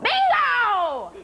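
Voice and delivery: high, drawn-out mock outburst; blurted shout. Woman with Tourette's interrupting bingo night, blurted shout. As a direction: blurt it high, stretched and falling. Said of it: "she'd blurt out".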